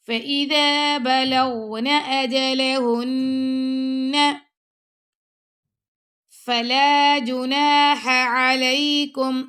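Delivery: slow chanted recitation; Quran recitation. Shown as a demonstration. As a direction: draw each word out in full recitation. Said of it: "teacher recites Quran verse".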